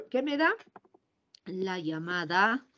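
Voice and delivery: normal talking voice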